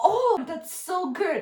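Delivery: praising the student's pronunciation, gushing; gushing praise. Acted. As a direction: overdo the praise. Overpraising the student.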